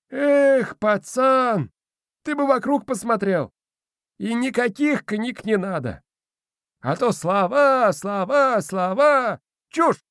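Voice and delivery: put-on high, scoffing old spirit; squeaky old man, dismissive; domovoi voice. A narrator's reading.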